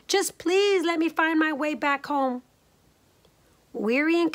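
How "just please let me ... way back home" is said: put-on high, pleading girl; small girl begging to get home; little girl voice. A narrator's reading. Leola voiced in story.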